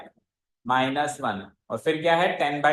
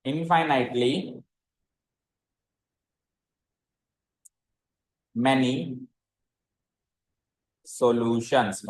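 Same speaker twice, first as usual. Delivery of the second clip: very slow, spaced word by word; slow dictation; 5 semitones lower, much slower than usual. Shown as a demonstration. Teacher dictating to students, word by word. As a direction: spell it out slowly for note-taking. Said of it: "लिखना".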